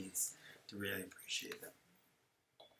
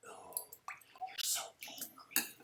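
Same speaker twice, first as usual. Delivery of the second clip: whispered taunt; 12 dB quieter than usual. Retold or imitated.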